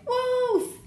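high shout of wolf; cried out. A narrator's reading. Boy crying wolf to skip violin lesson, high shout. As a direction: fake alarm call, pitched high. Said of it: "he cried".

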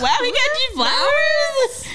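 high, slow, stunned wow; mock surprise. Daughter mimicking her own surprised reaction to the flowers, high and slow. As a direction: act floored that flowers came. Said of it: "I was like".

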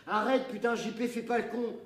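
loud, high panicked shout; alarmed yell. Acted. Narrator yells at JP balancing on the crane, loud and scared. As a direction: scream at him to stop.

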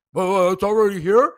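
mock Ken baffled the food came fast; mock whiny Ken. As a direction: put on a petty complaining voice. Mocking Ken upset food came.

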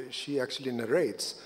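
ordinary talk, nothing marked

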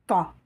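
"Ta" with a heavy emphatic Arabic t. Shown as a demonstration. Teacher contrasts two letters for learners, emphatic Ta. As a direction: say "Ta" with a dark, backed vowel. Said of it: "Vous entendez la différence?".

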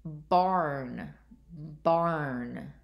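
BARN drawn out, low and falling; careful word model. Shown as a demonstration. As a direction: stretch BARN so the AR is heard. Teacher models test word for listening.

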